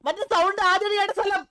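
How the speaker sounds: high, loud urgent call to teammates; alarmed shouting. Real, not acted. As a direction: raise the alarm loudly. Alarmed recognition of spooky place.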